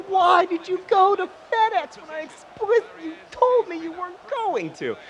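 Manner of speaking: high, incredulous mock shout; hammed-up exasperated cry; mock outraged yell. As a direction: yell it in playful disbelief. Retold outburst at partner.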